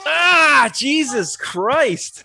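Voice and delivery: loud, high yelp, then drawn-out oath; real recoil, cry out and stretch the curse; horrified outcry. Real, not acted. Watching the gory sawing scene, appalled outburst.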